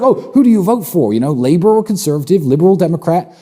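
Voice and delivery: hammed-up imitation of people quizzing him; mock eager questioner. People asking who he votes for, in a mock high questioning voice. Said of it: "everyone's like".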